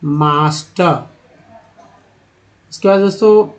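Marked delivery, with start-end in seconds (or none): 0.0-0.9 मास्टर said with a long aa; model pronunciation. Shown as a demonstration. Teacher models master for students. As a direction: say मास्टर with open aa, falling. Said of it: "क्या उच्चारण करते हैं".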